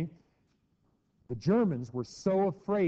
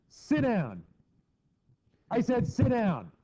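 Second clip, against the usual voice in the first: higher, louder, slow angry repeat; angry dad order; 9 semitones higher, 4 dB louder, slower than usual. Retold or imitated. Dad ordering a defiant child to sit, angry. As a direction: insist with rising anger like a fed-up dad. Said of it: "your dad says".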